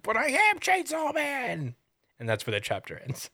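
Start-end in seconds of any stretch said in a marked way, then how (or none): 0.0-1.7 high, slow drawn-out protest; indignant cry. Retold or imitated. Denji insists to Squid Boy, high, slow and indignant. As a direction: cry it out high and long like a wounded hero. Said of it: "He's like".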